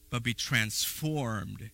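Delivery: slow, soft and drawn out; slow reading. Shown as a demonstration. Preacher reading Romans to the church, slowly. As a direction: stretch each word of be transformed.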